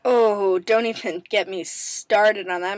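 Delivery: ordinary delivery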